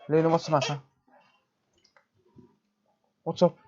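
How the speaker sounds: high sing-song voice talking to the cat; pet talk. Real, not acted. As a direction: coax and scold the cat in a high voice. Streamer scolding his crying cat.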